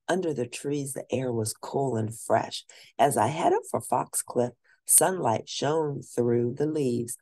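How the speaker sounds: ordinary delivery, nothing marked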